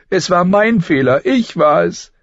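put-on high girl's voice, weeping; crying girl. A narrator's reading. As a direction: daughter crying out her guilt. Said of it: "weinte sie". (weinend, hohe Mädchenstimme)

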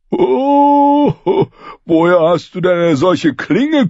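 put-on high, jeering boy's voice; mocking boy. Hot Pie mocking Arya's blade, high and jeering. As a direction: sneer with fake wonder.

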